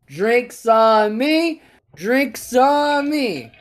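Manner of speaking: high, loud, long-drawn-out holler; big barroom announcement. Acted. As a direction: call it out to the whole bar, stretching each word. Joke's man announcing a free round.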